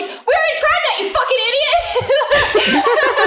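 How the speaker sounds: very high-pitched imitated outburst, last words dragged out; mock exasperated yell. Leia scolding Han in the trash chute, exasperated. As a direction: berate him in a hammed-up shriek of exasperation.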